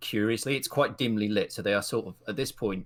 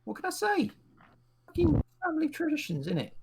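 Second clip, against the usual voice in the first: much higher cheeky voice. Acted. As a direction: toss it off, cheeky and high. GM voices NPC, high.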